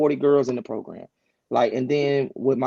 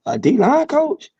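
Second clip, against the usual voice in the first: high, slow, incredulous "A D line coach?"; stunned disbelief; 12 semitones higher, 5 dB louder, slower than usual. Retold or imitated. Coach retelling his reaction to his wife's advice, incredulous. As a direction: echo it back like you can't believe the offer. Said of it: "And I'm like".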